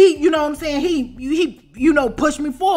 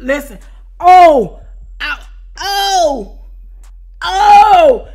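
high, loud laughing yelps of pain; mock cry of pain; 6 semitones higher, 12 dB louder, much slower than usual. Showing the crowd how she sounded when he got her, loud yelps. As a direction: yelp and cry out, hammed up. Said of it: "that's how I sounded when he got me".

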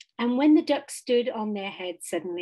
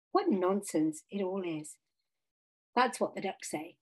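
plain reading voice

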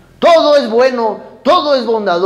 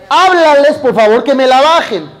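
higher, louder demand to the wife; impatient request; 4 semitones higher, 5 dB louder than usual. Retold or imitated. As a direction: push the request, a little fed up. Husband asks wife, impatient. (esposo impaciente pide a su esposa, más fuerte y agudo)

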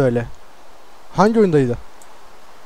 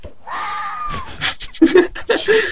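fox screech imitation. Fox sound after throwing fox.

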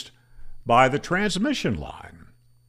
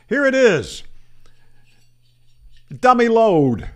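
higher and louder, a gotcha punchline; spring the surprise on the viewer; ta-da, it's just a dummy load; triumphant reveal; 7 semitones higher, 6 dB louder than usual. Acted. Punchline reveal of the trick antenna.

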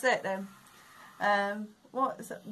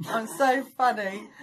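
smug footballer; 3 semitones higher, 7 dB louder, slower than usual. Retold or imitated. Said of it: "then he goes".

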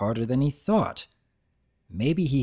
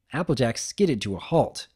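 usual speaking voice

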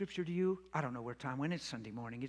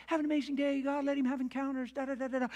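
praying wife; 7 semitones higher than usual. Retold or imitated.

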